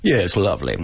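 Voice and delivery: exaggerated British "lovely"; put-on British accent, playfully overdone; mock British accent. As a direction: imitate a caricature Brit. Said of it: "not every British person goes".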